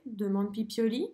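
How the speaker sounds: ordinary delivery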